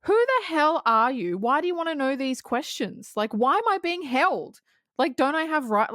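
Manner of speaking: high, indignant retold anger; pissed off. Roy demanding answers from his questioners, angry. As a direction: demand answers, mock furious. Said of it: "he's pissed off".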